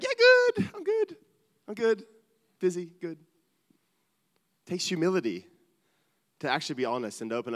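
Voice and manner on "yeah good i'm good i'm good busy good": very high, squeaky I'm-fine; fake cheery church voice. Pastor mocking churchgoers pretending to be good, squeaky high. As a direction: chirp it up high like you're hiding everything. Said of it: "And you can hear, like".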